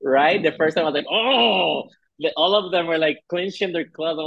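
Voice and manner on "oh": shocked reaction. Retold or imitated. Acted shocked reaction to the hit.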